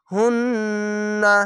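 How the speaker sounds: very slow হুন্না with a long held nasal ন্ন; nasal ghunna. Shown as a demonstration. Teacher shows the obligatory ghunna on হুন্না. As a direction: hum through the ন্ন of হুন্না slowly. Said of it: "এখানে ওয়াজিব গুন্না".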